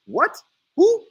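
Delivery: high, loud retold comeback; incredulous challenge. Acted comeback to a threat.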